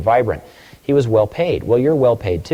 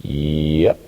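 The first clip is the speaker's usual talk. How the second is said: put-on low, drawn-out good old boy; slow, low-pitched country phone greeting; 6 semitones lower than usual. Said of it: "Good old boy answers the phone".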